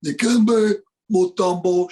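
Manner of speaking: put-on loud, strained African-accented player voice; Dikembe Mutombo. Impressionist announcing the African center, big booming caricature. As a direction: belt out the name as a big cartoonish voice. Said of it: "the big, deep voice".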